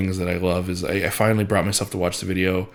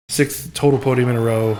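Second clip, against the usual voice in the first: excited emphasis; 4 semitones higher, 5 dB louder than usual. Real, not acted. Correcting his podium count.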